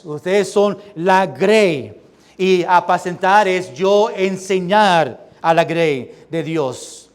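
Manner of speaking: normal talking voice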